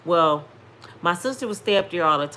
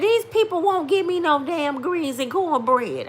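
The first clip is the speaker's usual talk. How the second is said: high, louder indignant mama voice; complaining mother; 10 semitones higher, 5 dB louder than usual. Retold or imitated. Mother grumbling on the phone after her coma, indignant and high. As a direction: imitate an outraged elder griping about food.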